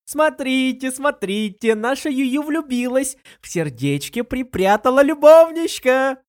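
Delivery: loud, teasing cry, building; shouting. A narrator's reading. Lin Yueru shouts teasingly that her friend is in love. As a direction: announce it loudly to everyone, teasing. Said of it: "прокричала Лин Юэру".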